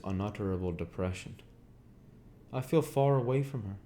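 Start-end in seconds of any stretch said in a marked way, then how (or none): 2.5-3.8 higher, lightly voiced Gatsby, wistful; a lonely Gatsby, raised pitch; Gatsby's voice. A narrator's reading. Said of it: "he said".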